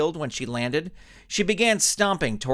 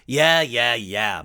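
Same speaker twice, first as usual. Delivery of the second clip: mocking; 3 semitones lower, 4 dB louder, much slower than usual. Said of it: "mocked the Blinding Skull".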